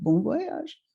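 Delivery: put-on young girl's tentative repeat; questioning echo. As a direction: echo it back with a lifted, uncertain ending. Her younger self echoes the Frenchman.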